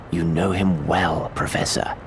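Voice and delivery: drawn-out, slow, teasing hint; slow suspense. Acted. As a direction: draw it out to tease the big reveal. Building suspense before the reveal.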